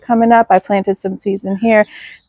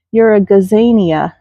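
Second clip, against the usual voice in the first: ordinary talk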